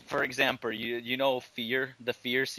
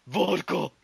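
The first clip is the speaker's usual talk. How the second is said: mock yell; 5 semitones higher, 3 dB louder than usual. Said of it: "yelling out".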